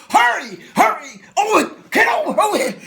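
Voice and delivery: frantic high yelling for the pilot; panicked shout. Retold or imitated. Passenger yelling to the pilot in panic. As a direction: scream for Owen to come quick. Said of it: "and he said".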